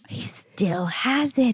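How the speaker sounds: whispered under the breath; hushed aside. Real, not acted. Friend gossiping about Joe, softly whispered.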